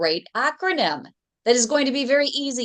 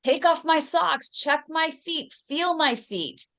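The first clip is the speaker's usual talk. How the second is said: patient instructing doctor; 6 semitones higher than usual. Acted. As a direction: spell out each request to the doctor, pitched up. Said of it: "That means".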